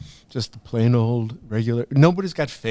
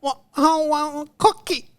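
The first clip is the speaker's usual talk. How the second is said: put-on baby voice. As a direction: babble like a baby. Baby voice speaking.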